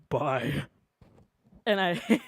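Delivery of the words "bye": lower, deep one-word bye; a deep bye to pass as the brother. Retold or imitated. Hanging up on friend's brother with a deep bye. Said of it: "my bye was so deep".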